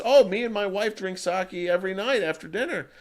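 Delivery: lightly voiced old man talking about his wife; old man reminiscing. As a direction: tell it fondly, like a grandfather. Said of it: "the old man goes".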